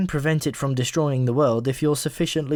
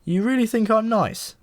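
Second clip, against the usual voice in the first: higher, flustered disbelief; blushing; 9 semitones higher, slower than usual. A narrator's reading. Malcolm, embarrassed by the compliment, asks in high surprise. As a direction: repeat it, caught off guard and pleased. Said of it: "said Malcolm, blushing".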